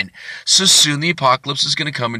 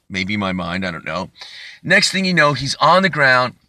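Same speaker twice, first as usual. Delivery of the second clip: ordinary delivery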